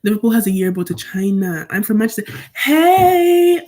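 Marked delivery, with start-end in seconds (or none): 2.5-3.6 high, loud drawn-out squeal; excited greeting. Real, not acted. Streamer spotting a viewer in the chat, excited greeting. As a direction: call out hello, high and stretched.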